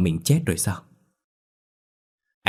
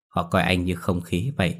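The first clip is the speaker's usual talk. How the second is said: ordinary delivery, nothing marked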